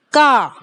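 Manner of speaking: का with a long drawn aa, loud; long vowel. Shown as a demonstration. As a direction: stretch the aa in का. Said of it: "देखिए, long sound".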